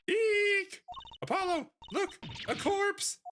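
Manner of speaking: high, shrill-voiced mock scream of fright; shrieking fright. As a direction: squeal in hammed-up horror at the body. Girl spots the mannequin body.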